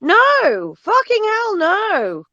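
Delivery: high, drawn-out outraged no; slow, high, scandalized no; emphatic refusal. Real, not acted. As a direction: stretch it out, appalled. Emphatic refusal after mock quote.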